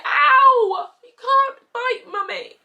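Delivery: high, loud ow through tears; tearful retold ow. Mum retelling her shout at toddler, tearful and loud. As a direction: cry out ow then slow plea.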